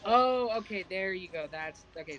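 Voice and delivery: usual speaking voice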